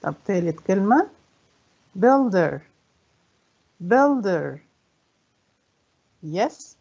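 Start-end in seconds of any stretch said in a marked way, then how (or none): 2.0-2.6 "builder" as BUIL-der, falling; stress on first syllable. Shown as a demonstration. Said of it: "أول مقطع". 3.9-4.6 "builder" as BUIL-der, falling; stress on first syllable. Shown as a demonstration. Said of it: "أول مقطع".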